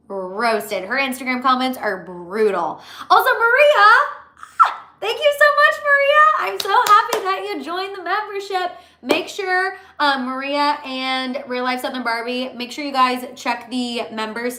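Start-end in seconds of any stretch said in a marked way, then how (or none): 3.1-8.7 high squealing thank-you; excited. Real, not acted. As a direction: gush with delight, pitched way up. Excited thanks to new member.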